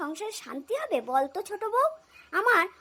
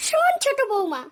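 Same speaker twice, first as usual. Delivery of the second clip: loud, raised-pitch summons; stern scolding call; 4 semitones higher, 7 dB louder than usual. Acted. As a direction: call her to attention loudly, ready to scold. Mother-in-law starts scolding daughter-in-law. (কড়া গলায় জোরে ধমকের ডাক)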